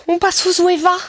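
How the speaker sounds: high, squeaky put-on brand name; mock YouTuber voice. Afraid of damaging the Zoeva brush, high mock-precious voice. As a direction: say it like a parody beauty vlogger, pitched high.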